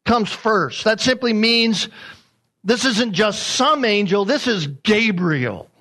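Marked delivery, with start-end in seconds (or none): 4.2-5.7 "Gabriel" drawn out with weight, then falling; single out "Gabriel" as no ordinary name; slow "this is Gabriel", landing hard on "Gabriel"; emphasis on Gabriel. Shown as a demonstration. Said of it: "put emphasis there".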